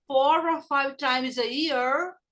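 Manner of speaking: slightly slow phrase with the words run together; connected model phrase for learners; repeat-after-me, linked. Shown as a demonstration. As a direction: link the words smoothly, rising at the end. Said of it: "Repeat after me".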